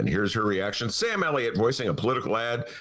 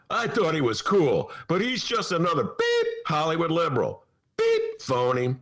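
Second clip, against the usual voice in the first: mock maga tweet; 9 semitones higher than usual. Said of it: "Sample reaction is".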